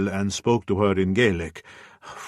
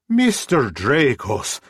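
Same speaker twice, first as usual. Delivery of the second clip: put-on older Scottish woman, higher and slow, thick brogue; 6 semitones higher, 4 dB louder, slower than usual. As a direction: greet him warmly in a broad Scottish accent. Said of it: "she said in her thick Scottish brogue".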